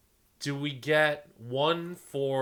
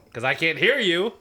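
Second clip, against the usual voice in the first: higher, louder teasing shout; mock defiant; 6 semitones higher, 9 dB louder than usual. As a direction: drown out any objection playfully. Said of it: "don't disagree with me".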